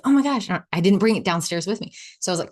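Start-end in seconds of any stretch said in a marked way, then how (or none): 0.0-0.5 excited surprise. Retold or imitated.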